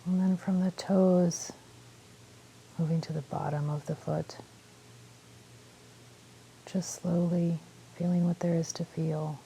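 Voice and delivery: calm meditation guide with long pauses; unhurried phrases, leaving space to breathe; guided meditation. Real, not acted. Guiding listeners through a body scan, calm meditation voice.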